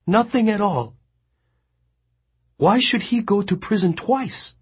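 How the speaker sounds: weeping. A narrator's reading. The boy weeping in Mongnan's arms. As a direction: choke it out through tears. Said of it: "He was weeping uncontrollably".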